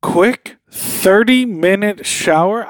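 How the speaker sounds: slow loud disbelieving echo; incredulous. Real, not acted. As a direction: repeat it in shocked disbelief.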